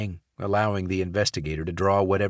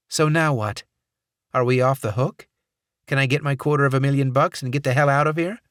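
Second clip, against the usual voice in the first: higher, louder, fast pushy voice; impatient demand; 7 semitones higher, 6 dB louder, faster than usual. Acted. Watts presses Barrett to pay up, fast and impatient. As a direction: rush for the money, impatient.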